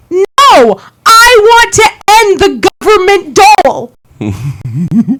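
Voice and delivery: slow, punchy mic-drop reply; emphatic retort. Retold or imitated. Retelling Adrian's comeback to a committee man, slow and emphatic. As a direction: land each word of the comeback slowly. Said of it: "And the room explodes".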